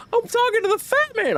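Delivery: very high, mock tearful kid whine; tearful nephew. Uncle imitating his teased nephew near tears, high-pitched mock upset. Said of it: "starting to get in tears".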